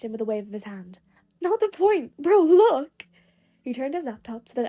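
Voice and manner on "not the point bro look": high, loud and eager; eager shout to his friends; excited insisting. Acted. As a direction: wave the question away and push the laptop at them. Denki brushes off Kirishima, insisting.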